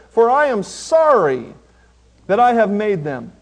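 loud, slow declaimed verse; forceful scripture reading. Acted. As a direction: proclaim God's judgment loudly, with weight. Declaims God's words from scripture.

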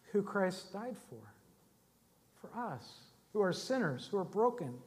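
very soft, reverent near-murmur; hushed solemnity. Real, not acted. Preacher telling congregation whom Christ died for, hushed. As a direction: drop right down and let each phrase settle.

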